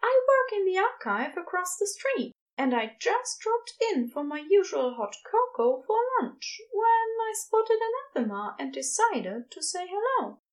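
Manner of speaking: high, eager character voice; Ezra, chatty and eager. A narrator's reading. Ezra tells Crowley why they dropped in, eagerly.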